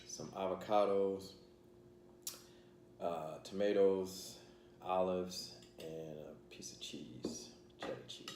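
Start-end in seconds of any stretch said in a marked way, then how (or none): 5.8-8.3 soft unvoiced whisper. Real, not acted. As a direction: whisper the last items. Listing food, drops to whisper.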